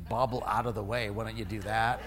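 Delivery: low-pitched, grumbled inner complaint; mildly irritated, kept under the breath; muted irritation. Retold or imitated. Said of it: "I'm getting irritated".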